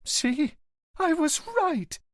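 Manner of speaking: high triumphant near-yell at her husband; slow, high cry of vindication; wife half-yelling she was right. Acted. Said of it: "the woman practically yelled at her husband".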